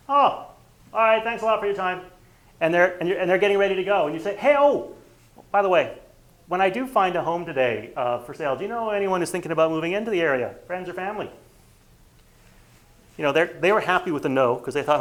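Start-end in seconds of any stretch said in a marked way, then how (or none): 0.1-2.0 high, loud cheerful sign-off; mock cheerful door knocker; breezy agent. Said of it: "his door knocking script". 4.4-11.3 high, loud, one more thing; agent's Columbo one-more-question at the door; Columbo-style afterthought. Retold or imitated. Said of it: "Columbo style second".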